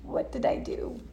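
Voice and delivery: soft unvoiced whisper; a client's imagined regret, whispered; whispered self-doubt. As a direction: whisper it as an inner thought. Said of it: "they're starting to think".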